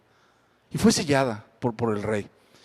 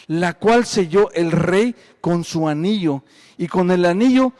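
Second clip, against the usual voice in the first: louder, proclaiming Bible reading; scripture reading; 4 semitones higher, 5 dB louder than usual. Acted. Preacher reads Daniel's story to his flock, loud and proclaiming. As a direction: read it out like a proclamation to the church.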